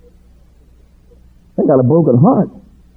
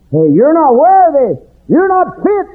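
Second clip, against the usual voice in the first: devil's taunt; 13 semitones higher than usual. Retold or imitated. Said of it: "That's to the devil".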